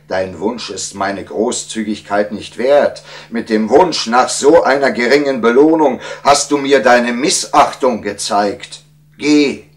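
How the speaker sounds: building to a high, loud rebuke; offended monarch dismissing a subject; annoyed king. A narrator's reading. King rebuking the wise man for disrespect, angrily. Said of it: "rief der König verärgert".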